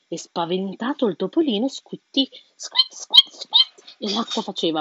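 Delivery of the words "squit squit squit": high squeaky mouse squeaks, dropping to a whisper. A narrator's reading. Narrator squeaking as the mouse to the child. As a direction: squeak it like a tiny frightened mouse.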